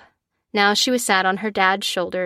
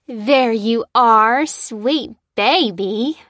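put-on long, drawn-out greeting to a child; delighted cry; 2 semitones higher, 5 dB louder, much slower than usual. A narrator's reading. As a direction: cry out fondly, stretching every word. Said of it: "cried Tessa".

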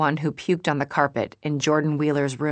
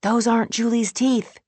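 raised, higher inner-voice cry; mild shout, pitched up in alarm; little voice shouting; 8 semitones higher, 4 dB louder than usual. A narrator's reading. A little voice in our heads, shouting. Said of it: "A little voice in our heads shouting".

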